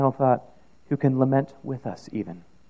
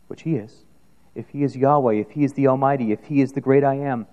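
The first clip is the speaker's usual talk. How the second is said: ordinary talk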